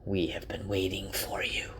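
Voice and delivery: creature whisper. A narrator's reading. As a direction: hiss it from the shadows like a lurking creature. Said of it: "and it says".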